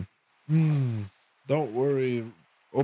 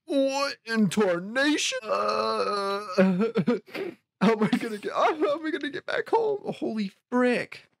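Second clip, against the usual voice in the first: panicked whine; 13 semitones higher than usual. Real, not acted. Player stranded far from home in the ocean, high panicked whine.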